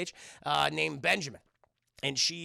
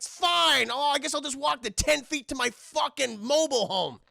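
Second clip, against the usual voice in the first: high, loud grudging outburst; huffy; 7 semitones higher, 5 dB louder than usual. Acted. Davey storming off to his trailer, huffy. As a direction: snap "fine" loudly, then grumble on up high.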